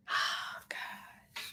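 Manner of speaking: breathy whisper of shock; whispered dismay. Real, not acted. Streamer reacting to sad chat story, whispered. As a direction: breathe it out, stunned.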